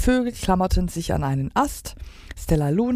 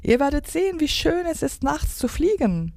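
high, enthusiastic lilt; thrilled young-bat voice; excited; 10 semitones higher than usual. A narrator's reading. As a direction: gush eagerly in a high voice. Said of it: "sagte Stella Luna aufgeregt".

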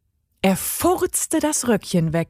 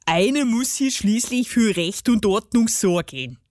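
put-on dialect dwarf voice; pompous little guardian of order; grumpy gnome justifying himself; self-important garden gnome. A narrator's reading.

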